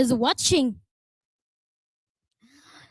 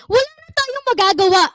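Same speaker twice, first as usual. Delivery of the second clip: exasperated cry; 7 semitones higher, 3 dB louder, faster than usual. Pretend. Mocking the defeatist saying.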